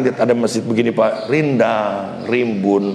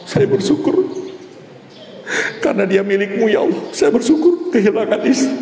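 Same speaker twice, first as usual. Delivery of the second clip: voicing a praying widower; 6 semitones higher than usual. Voicing widower's grateful prayer.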